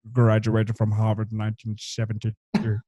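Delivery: lightly mock Rickman villain; Alan Rickman impression. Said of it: "it's the way he says".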